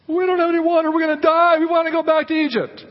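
high, whiny mock panic; hammed-up high-pitched complaint; complaining Israelites. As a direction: wail it like a people sure they are doomed.

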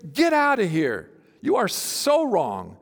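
ordinary delivery

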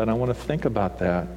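normal talking voice

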